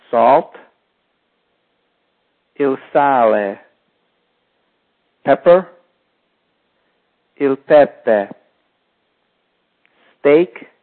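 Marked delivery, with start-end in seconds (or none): none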